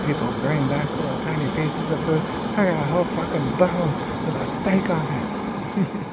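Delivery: high, bragging critter voice; cocky bird voice. Pretend. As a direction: boast like a proud little bird.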